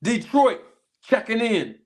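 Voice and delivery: ordinary talk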